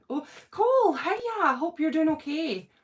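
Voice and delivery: high, loud hiya; excited hiya to a viewer; excited greeting. Real, not acted. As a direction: brighten at a familiar name. Greeting viewer joining live.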